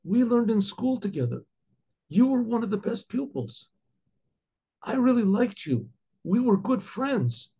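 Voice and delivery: lightly acted judge appealing to an old schoolmate; judge's appeal. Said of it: "And she says".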